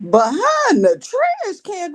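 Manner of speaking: high, loud, drawn-out disbelief; can't believe what you just heard; shocked disbelief. Real, not acted. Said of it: "shut your mouth and keep on talking".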